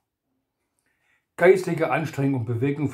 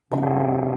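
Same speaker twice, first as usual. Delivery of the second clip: drill noise. A narrator's reading.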